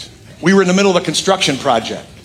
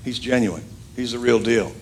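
Barnabas vouching calmly; 5 semitones lower, 6 dB quieter than usual. Retold or imitated.

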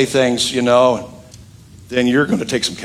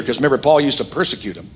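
usual speaking voice

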